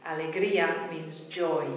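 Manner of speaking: normal talking voice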